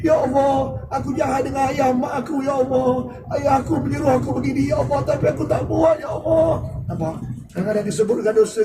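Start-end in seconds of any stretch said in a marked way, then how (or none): 0.0-6.7 crying out to God. Retold or imitated. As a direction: cry out in repentance over the parents. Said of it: "sampai kita menangis".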